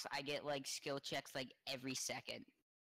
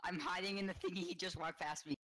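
being chased; 5 semitones higher, 4 dB louder than usual. Real, not acted. Hiding from the killer in game.

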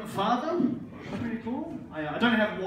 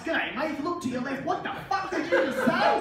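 a hint of an Australian tour guide, squeaking up at the end; lightly voiced Australian guide, rising to a high, baffled cry; 6 semitones higher than usual. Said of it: "as an Australian".

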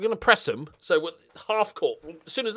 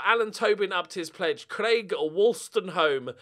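ordinary talk

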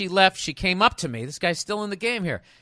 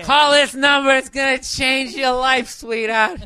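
put-on loud, higher, slow swaggering drunk; cocky drunk; 8 semitones higher, 6 dB louder, slower than usual. Host imagining the drunk writer hitting on the girl, swaggering. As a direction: hammer it home like a drunk ladies' man. Acting out drunk guy's pickup.

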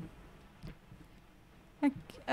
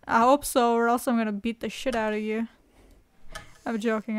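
ordinary talk, nothing marked

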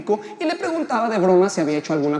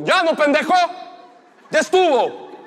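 loud, raised, fed-up shout; angry heckler's yell; 6 semitones higher, 6 dB louder, slower than usual. Acted. Angry audience member interrupting the comedian, acted yell. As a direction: yell it to cut the comic off. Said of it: "el güey gritó".

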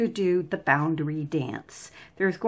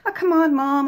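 put-on whiny kid; 8 semitones higher, 6 dB louder than usual. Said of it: "this is pretty common among kids".

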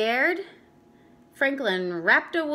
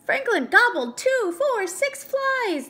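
excited counting; 12 semitones higher, 5 dB louder than usual. A narrator's reading. Excited count of gobbled flies.

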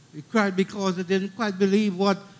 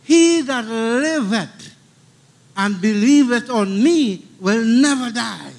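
declaimed verse; 4 semitones higher, 7 dB louder, slower than usual. Shown as a demonstration. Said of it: "It says".

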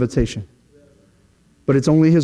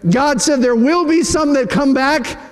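ordinary delivery

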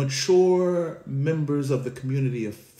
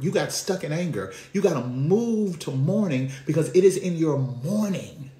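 preaching at full force; 4 semitones higher than usual. Acted. Preacher to congregation, rising to a full-force cry. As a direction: lift the congregation, preaching hard.

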